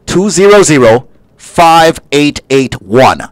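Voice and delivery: slow, spelled-out phone number; slow number read. Acted. Host reads out the phone number, slow and clear. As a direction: give each digit room for listeners. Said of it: "reads the phone number".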